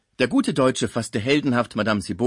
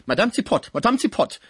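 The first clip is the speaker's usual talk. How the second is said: put-on admonishing in a German accent; high, loud call with German-accented consonants; Schmucke; 12 semitones higher, 6 dB louder than usual. A narrator's reading. German friend warns the concierge, high urgent German-accented admonishment. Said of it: "mahnte Schmucke in seiner deutschen Aussprache".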